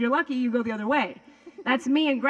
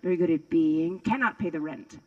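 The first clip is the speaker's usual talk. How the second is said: ordinary talk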